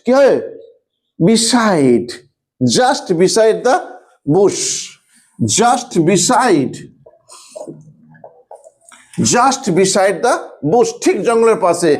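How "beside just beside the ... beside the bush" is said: words drawn out one at a time; slow dictation. Shown as a demonstration. Teacher dictating the sentence to students, very slowly. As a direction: dictate slowly so they can write.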